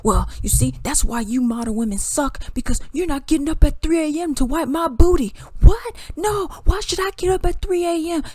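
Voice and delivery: hammed-up indignant outburst; mock attacking. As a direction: act out a heated debater piling on. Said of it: "they're just, they're, like, attacking".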